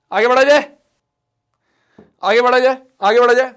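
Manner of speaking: loud, upbeat move on; announcing. Real, not acted. Teacher loudly announcing moving on to next topic. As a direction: call the class onward.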